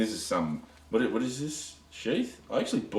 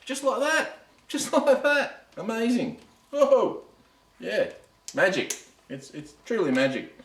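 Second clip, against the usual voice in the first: squeaky, much higher mock wonder with a laugh; mock amazement; 14 semitones higher than usual. Reviewer mocking his own quick regrind, squeaky mock amazement. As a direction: gush like a stage magician's fan, tongue in cheek.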